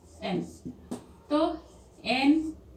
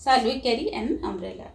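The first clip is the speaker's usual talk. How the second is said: ordinary talk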